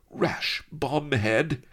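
high, slow alarmed cry. A narrator's reading. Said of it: "cried Sheba".

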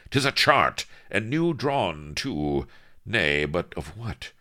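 lightly voiced old-time captain with loud bursts; a hint of stagey seafaring captain; excited pirate captain. A narrator's reading. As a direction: announce the find with archaic relish. Voices Avery reading chart.

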